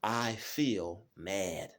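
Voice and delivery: slow, deliberate I feel mad; name the feeling calmly and slowly; drawn out, even I feel mad; slow calm self-talk. Shown as a demonstration. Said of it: "instead of just getting angry".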